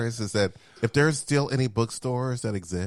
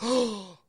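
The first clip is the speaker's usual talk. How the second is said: mock dramatic gasp; 12 semitones higher than usual. Mock gasp in retold story.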